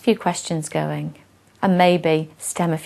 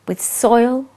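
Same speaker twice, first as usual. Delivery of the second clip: expressive read-aloud of a rhyming poem; storyteller reading verse to an audience; poem recitation; 3 semitones higher than usual. Acted. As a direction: recite it with relish, line by line.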